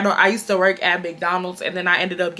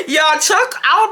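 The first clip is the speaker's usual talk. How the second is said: laughing; 7 semitones higher, 3 dB louder than usual. Real, not acted. Still laughing.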